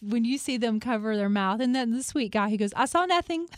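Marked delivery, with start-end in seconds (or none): 2.8-3.5 mock-nervous quote, pitched high; lightly acted high, nervous denial; nervous imitation. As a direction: imitate the nervous guy, about to giggle. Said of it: "that so nervously".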